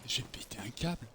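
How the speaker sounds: whispered, unvoiced defeat; whispering. Real, not acted. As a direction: whisper it to yourself. Mutters after a fall.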